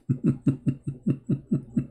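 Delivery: a short, soft scornful laugh; mocking laugh. Real, not acted. Debater laughing off the opponents' context objection, scornful. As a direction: laugh quietly at their excuse.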